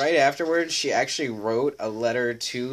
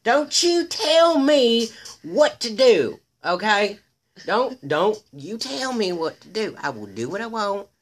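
put-on drunk angry yelling; 12 semitones higher, 6 dB louder than usual. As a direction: scream it like a drunk refusing to leave. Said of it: "she began screaming and cussing".

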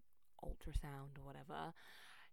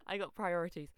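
ordinary delivery

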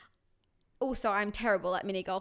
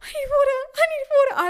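high, loud, imitated winded panic; hammed-up gasping plea; out of breath; 13 semitones higher, 10 dB louder than usual. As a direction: act desperate and breathless, pitch climbing. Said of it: "I was so out of breath".

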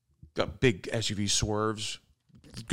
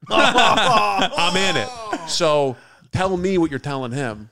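defiant taunt; 5 semitones higher, 6 dB louder, much slower than usual. Retold or imitated. Confronting driver in retold fight.

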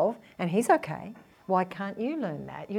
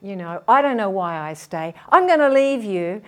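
retold furious rant, louder by the end; angry outburst; 8 dB louder than usual. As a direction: lash out at the partner, rising to a shout. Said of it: "loses it and says".

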